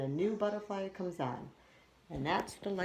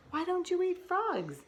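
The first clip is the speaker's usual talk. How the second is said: high, loud curious frog; a small frog asking the wolf; squeaky cartoon frog; little frog voice; 4 dB louder than usual.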